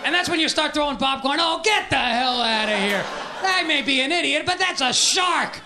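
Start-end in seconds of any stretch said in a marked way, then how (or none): 1.4-5.6 yelling heckler. Acted. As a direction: shout it like an annoyed moviegoer. Audience heckling the movie.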